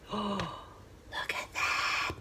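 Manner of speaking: a slow awed whisper. Real, not acted. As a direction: breathe it out in wonder. Gasp at the cut slice.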